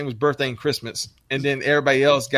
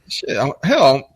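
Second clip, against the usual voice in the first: ordinary delivery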